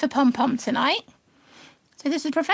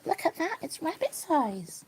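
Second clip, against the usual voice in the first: whisper; 8 dB quieter than usual. Real, not acted. Whispering to rabbit at pumpkin.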